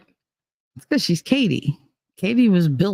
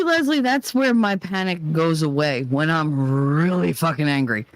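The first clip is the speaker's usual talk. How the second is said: ordinary delivery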